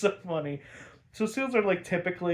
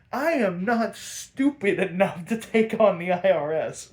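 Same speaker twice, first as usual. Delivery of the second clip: louder, higher, hammered-out punchline; acted insistence, raised voice; emphatic retold refusal; 4 semitones higher, 5 dB louder than usual. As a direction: declare it firmly as a mock Joker.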